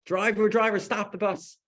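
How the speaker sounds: high-pitched yell to the driver; imitated desperate call for help; retold scream. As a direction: cry out loud, then ease off on the last words. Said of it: "She's screaming".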